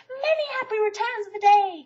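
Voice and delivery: Pooh calling loudly across the river. A narrator's reading. Pooh calls his birthday wish to Eeyore across the river. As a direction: shout it cheerfully across the water. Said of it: "called out Pooh".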